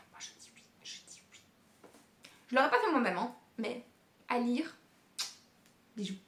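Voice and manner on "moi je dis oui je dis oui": whispered, much softer; dreamy whispered yes to a Christmas wedding. Real, not acted. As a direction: breathe it out like a secret yes. Dreamy aside about Christmas wedding.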